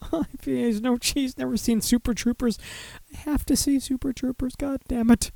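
high, soft pretend whining; mock whimper. Host losing friends over his tastes, mock whimper. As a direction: lament it in a small high voice.

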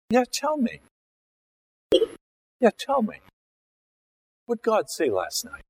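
high mocking yeah, tell me; sarcastic. Defiant king sassing the prophet, high mocking tone. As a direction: brush him off with sneering interest. Saul sarcastically answering Samuel.

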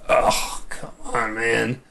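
quiet, breath-heavy groan of dismay; exasperated groan. Retold or imitated. Host retelling dismay at a teaser kiss, exasperated groan. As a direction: groan it out, letting it sink away.

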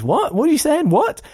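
high puzzled "what are you saying"; squeaky confused what; baffled listener. Retold or imitated. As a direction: act out a confused shop assistant. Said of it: "all anyone thinks is".

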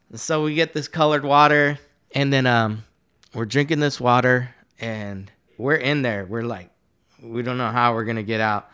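ordinary delivery, nothing marked

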